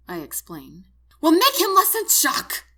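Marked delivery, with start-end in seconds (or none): 1.2-2.6 high, loud, frantic demand; screaming. A narrator's reading. Wife orders medic to fix her husband, screaming. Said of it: "she screams".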